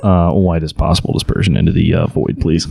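deadpan officer. Retold or imitated. Officer orders beaming her out.

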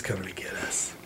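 hushed, low whisper of pretend dread; mock-fearful whisper. Host warns his friends they are being hunted, mock-fearful whisper.

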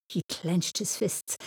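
plain reading voice

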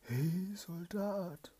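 high, loud eerie call from the tower; spirit calling. Acted. Evil spirit calls the soldier from the tower, high eerie shout. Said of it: "fragte der Soldat".

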